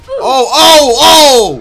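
loud, high shocked cries; startled yell. Real, not acted. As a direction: yell in sudden alarm as you get shot. Shot suddenly in game.

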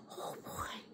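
hushed unvoiced whisper; just breath, no voice. Real, not acted. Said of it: "whispered aside to pet".

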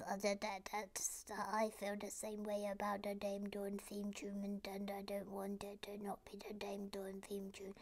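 soft, monotone put-on voice; small timid voice. Viewer Little Sue worries about theme tune, small voice. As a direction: speak small and hushed on one note. Acting Little Sue's message.

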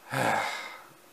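a long, soft, tired sigh; weary sigh. Acted. Driver sighs before a trip with Afton, soft and weary. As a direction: breathe out the dread of the day. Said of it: "Afton's driver sighed".

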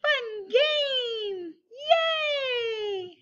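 squealing high, happy cheer; excited cheer. Acted. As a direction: cheer like a delighted kid. Cheering at start of game.

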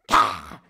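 loud, harsh monkey cry; imitated monkey screech. Monkey suddenly noticing the audience, loud imitated screech. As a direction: let out a sudden monkey roar. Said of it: "the monkey went".

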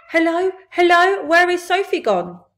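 high, loud calling out; imitated shouting. Giant imitates parents searching for Sophie, shouting. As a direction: call out as worried parents searching.

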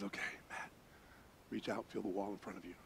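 near-whispered instructions; hushed guide. Retold or imitated. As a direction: guide him very softly through the dark. Guide instructs in the silent dark.

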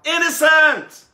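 high, loud, slow crowd shout; yelled out. Acted. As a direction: shout the verdict with joy. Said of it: "the whole town yelled out".

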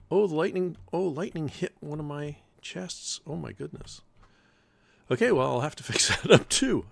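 high, loud flustered exclaiming; flustered laughing disbelief; dismayed surprise. Real, not acted. Discovers lightning damage.

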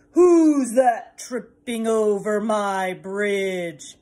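slow, loud booming troll; troll challenging the tiny goat, slow and booming. Acted. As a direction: draw it out like a mean old troll. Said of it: "he heard a voice".

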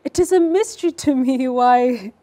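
slow, loud, smirking read with a laugh; the mother mocks the father's taste with a slow smirk. Shown as a demonstration. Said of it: "I like this smirk".

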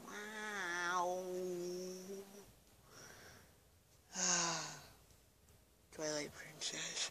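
talking through a yawn; drawn-out yawny speech. Real, not acted. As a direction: let a real yawn stretch the words. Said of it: "why do I keep yawning?".

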